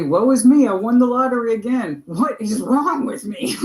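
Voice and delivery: mock self-pity. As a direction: ham up the martyr moaning about her luck. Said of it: "it's always gonna be".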